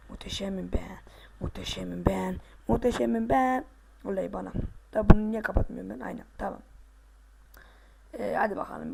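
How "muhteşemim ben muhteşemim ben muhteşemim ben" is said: playful whisper. Pretend. Gamer whispering his boast to himself after a jump. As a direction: let it out as a smug secret. Repeated boast while playing.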